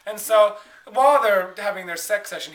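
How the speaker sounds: ordinary delivery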